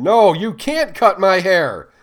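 high, slow, piercing scream of refusal. A narrator's reading. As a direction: scream it out like a trapped wild animal. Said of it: "she screamed".

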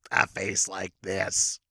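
very slow, low disguised voice; mysterious stranger. Acted. Podcaster showing the mysterious stranger to the co-host, disguised voice. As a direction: put on a low, drawn-out sinister voice. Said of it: "Realistically, it's a voice that".

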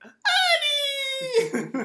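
high loud wailing Annie; Jar Jar impression. Retelling Robot Chicken Jar Jar, high loud wail. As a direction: wail it like a cartoon alien. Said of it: "When he's like".

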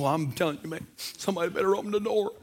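ordinary delivery

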